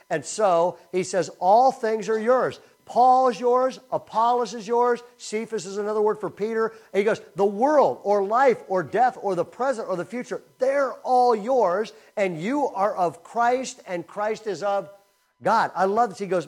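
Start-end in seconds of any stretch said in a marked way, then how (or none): 1.4-5.0 loud, high, punching out each phrase; rousing proclamation. Acted. Preacher proclaiming Paul's promise to believers, rousing. 7.3-15.7 loud, raised pitch, building through the list; rousing proclamation. Acted. Preacher reading out all that belongs to believers, rousing.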